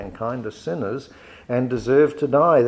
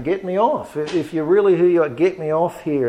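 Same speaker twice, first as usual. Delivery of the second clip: higher, louder mock plea from the cross, fast in the middle; mocking demand; 6 semitones higher, 4 dB louder than usual. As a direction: sneer it at Jesus like the jeering criminal. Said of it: "is mocking Jesus and saying".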